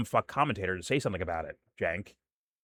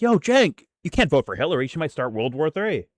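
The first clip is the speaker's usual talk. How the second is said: high loud "Yo" then urgent plea; calling out a warning; 6 semitones higher, 4 dB louder than usual. Retold or imitated. Fans warning Cenk about Hillary, loud high call. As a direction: hail him from across the room. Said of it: "We're like".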